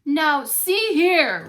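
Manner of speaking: slow loud roar; Papa Bear roaring at Goldilocks. Acted. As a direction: roar it at the intruder. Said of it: "roared Papa Bear".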